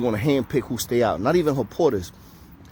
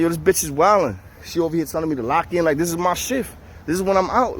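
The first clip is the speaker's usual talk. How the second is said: retold brash protest, pitched up; brash ignorant talk; 6 semitones higher than usual. Inmate protesting to the squad about his shift, brash. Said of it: "I'm talking my ignorant shit".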